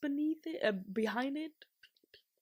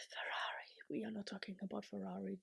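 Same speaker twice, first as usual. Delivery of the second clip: hushed whisper, fast and unvoiced; quick embarrassed whisper; 2 semitones lower, 10 dB quieter than usual. Real, not acted. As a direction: whisper it like a guilty secret. Whispering aside about Ferrari.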